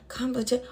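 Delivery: quiet, halting attempt at COMPETI; sounding it out. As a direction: murmur COMPETI as if working out its spelling. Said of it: "I'm sounding it out like".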